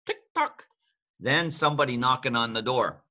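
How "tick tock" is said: very high tick tock; clock tick-tock. Shown as a demonstration. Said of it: "Then the clock".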